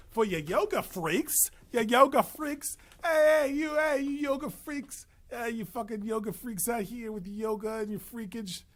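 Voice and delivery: high, loud put-on holler; hammed-up calling out, escalating then easing slightly; mock heckling. Host calls out the yoga freaks, mock heckling.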